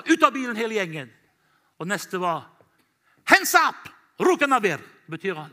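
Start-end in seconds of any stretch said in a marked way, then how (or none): 0.0-1.1 high, loud soldier's order; shouted order. Retold or imitated. 3.3-4.8 very high, loud hands-up yell; shouted order. Retold or imitated.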